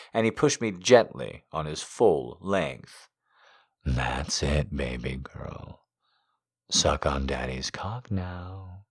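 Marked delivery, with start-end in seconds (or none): 3.8-8.9 soft low sweet whisper. A narrator's reading. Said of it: "he whispered sweetly".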